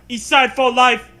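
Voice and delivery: high loud hammed-up gangster yell; mock gang shout. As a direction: shout it like a street crew boast. Voicing graffiti as a gangster.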